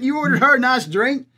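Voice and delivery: ordinary talk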